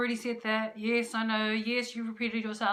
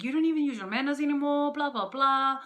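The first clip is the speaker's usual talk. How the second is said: higher, louder, fast complaining husband; mock husband complaint; 4 semitones higher, 5 dB louder than usual. Husband scolding her for no manners, fast mock complaint. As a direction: rattle off his gripes quickly. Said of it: "And he was like".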